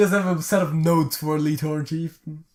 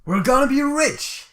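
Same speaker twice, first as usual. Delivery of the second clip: greedy adventurer voice; 10 semitones higher, 6 dB louder than usual. Pretend. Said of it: "I can't really do the voice".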